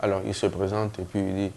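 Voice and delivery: normal talking voice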